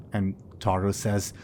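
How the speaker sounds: ordinary talk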